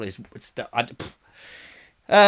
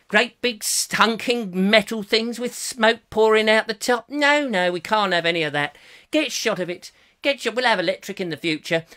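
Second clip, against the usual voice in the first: Sir Richard Beeching; 3 semitones higher than usual. Acted. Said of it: "recordings of him going".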